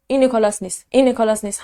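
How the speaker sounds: ordinary talk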